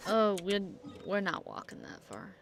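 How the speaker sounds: ordinary talk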